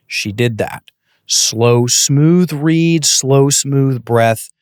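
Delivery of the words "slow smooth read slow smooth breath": drawn-out, smooth, sustained words; slow and smooth. Shown as a demonstration. Coach demonstrating the slow reading step, slow and smooth. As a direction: stretch each word out smoothly and slowly. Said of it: "Slow read".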